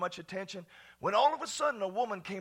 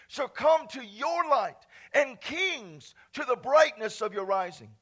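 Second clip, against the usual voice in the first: loud, ringing Bible reading; scripture read with fervor; 3 semitones higher, 6 dB louder than usual. Acted. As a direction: proclaim the verses to the congregation. Reading Isaiah aloud in preaching voice.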